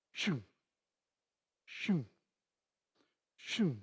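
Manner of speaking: soft, evenly spaced whooshes; mouth-made whoosh for each hole; digger sound effects. Retold or imitated. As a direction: imitate blades sliding easily into soil. Mimics digger going hole to hole.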